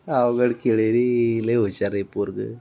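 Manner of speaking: low, soft, drawn-out doting; tender praise. Real, not acted. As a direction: marvel quietly and slowly at the little one. Doting on the laughing child. (लाडाने, हळू आणि सावकाश कौतुक)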